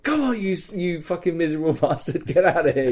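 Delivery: high, loud retold hurry-up; urgent urging. Rescuer to the captive he just cut loose, urgent shooing. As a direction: urge him away, half laughing.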